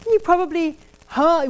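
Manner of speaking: usual speaking voice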